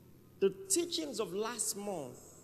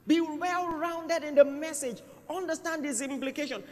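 preaching at full force; 9 semitones higher, 6 dB louder than usual. Real, not acted. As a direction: hammer each command home. Preacher exhorting congregation.

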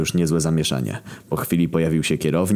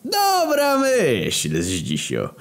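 long drawn-out, very high delighted cheer; tipsy enthusiasm, dropping on the name; eager agreement; 13 semitones higher, much slower than usual. A narrator's reading. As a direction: seize on the idea, stretching it gleefully. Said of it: "podchwycił ochoczo".

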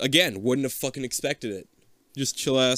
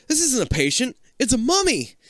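higher, louder astonished doctor; doctor voice spotting the mummy, higher; excited female doctor; 13 semitones higher, 4 dB louder than usual. A narrator's reading. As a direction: reveal the discovery with glee. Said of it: "she whispers to the other doctor".

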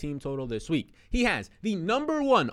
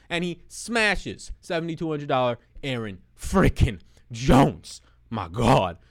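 slow build to a loud shout on the name; worked up; 4 semitones lower, 4 dB louder, slower than usual. Real, not acted. Fantasy host hyping Aaron Jones to viewers, excited. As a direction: get fired up and yell the name. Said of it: "I'm worked up. I'm getting excited".

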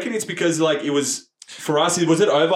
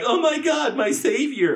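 much higher gushing "Oh my God, my savior"; mock swooning; 9 semitones higher, slower than usual. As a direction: gush like a rescued fan, high. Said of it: "not like".

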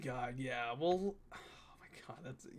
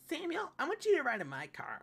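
high, mock-girlish voice; put-on high voice for a friend; imitated female friend; 10 semitones higher, 3 dB quieter than usual. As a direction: imitate her asking, pitched way up.

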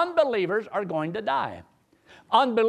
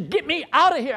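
retold frantic plea; 9 semitones higher, 4 dB louder than usual. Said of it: "She says".

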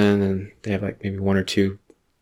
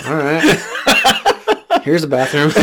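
1 mock realtor; 7 semitones higher, 3 dB louder than usual. Playing the realtor showing house.

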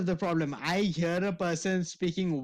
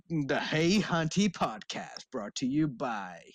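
slow, lower announcer read; podcast intro voice; 4 semitones lower, slower than usual. Shown as a demonstration. Host contrasting his on-air self, slow announcer voice. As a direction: read it like a podcast sponsor tag. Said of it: "it's either that or it's like".

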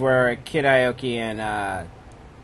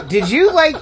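ordinary talk

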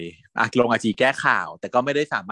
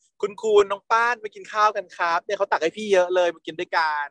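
high, calling across the set; 7 semitones higher than usual. Retold or imitated. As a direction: call them over to eat, pitched up. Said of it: "ตะโกนมาว่า".

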